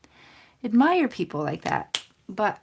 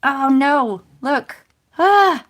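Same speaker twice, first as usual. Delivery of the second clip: higher and louder, a sudden oh no; dismayed surprise; 7 semitones higher, 12 dB louder, much slower than usual. Real, not acted. Crafter spotting her own mistake, dismayed cry.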